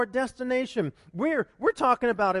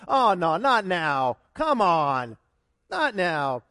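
mock annoyed; 4 semitones lower, 5 dB louder, slower than usual. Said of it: "It's gonna be like".

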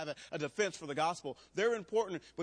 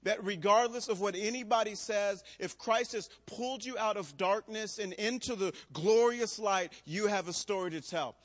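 louder, ringing pulpit preaching; 4 semitones higher, 5 dB louder than usual. Shown as a demonstration. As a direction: preach it out to the room with full conviction. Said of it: "insert a moment of preaching".

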